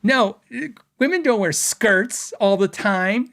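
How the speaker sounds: lightly voiced wife setting him straight; mock wife. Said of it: "She's like, she's like".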